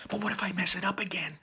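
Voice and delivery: high, quick, whispery worry; anxious whisper. Acted. Ian to his brother before the spell, anxious whisper. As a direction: fret in a high, hurried whisper.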